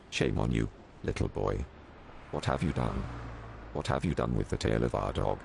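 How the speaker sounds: low, gruff cop voice; stern policeman. A narrator's reading. Policeman scolding little Max, low and gruff. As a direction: scold him from deep down.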